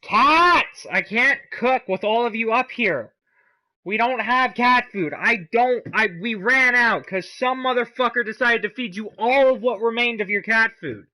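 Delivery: loud, high scolding; real exasperated yell. Real, not acted. Cook to cats underfoot while cooking, exasperated yell. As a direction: yell at pets crowding you, high and loud.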